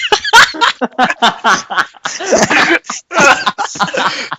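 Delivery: long high, loud cackling; real laughing fit. Real, not acted. Narrator cracks up at an improvised line, high loud laughter.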